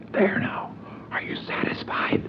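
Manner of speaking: hushed exasperated whisper. Retold or imitated. Howard after pulling the watch apart, exasperated whisper. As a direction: grumble it under your breath.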